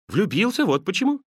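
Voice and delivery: high-pitched, quick-hearted confession; young nephew's eager admission; eager reply. Acted. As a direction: answer brightly, pitch lifted. Nephew earnestly answers his uncle.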